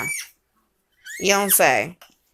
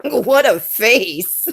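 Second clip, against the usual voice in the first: ordinary delivery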